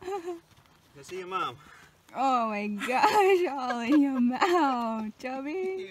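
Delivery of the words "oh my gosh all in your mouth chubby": loud, high drawn-out exclamation; big slow squeal of mock dismay; playful exclaiming. Real, not acted. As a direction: exclaim at a pet, stretched out and high. Gushing at Chubby, messy mouth.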